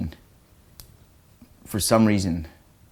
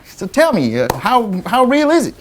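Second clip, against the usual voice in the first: folksy alien believer; 10 semitones higher, 3 dB louder than usual. Retold or imitated. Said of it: "a guy that, like, believes in aliens".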